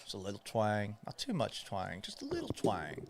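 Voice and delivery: a light Southern twang, barely there; hint of a Southern accent, softly played up; little Southern twang. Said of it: "speak with the Southern accent".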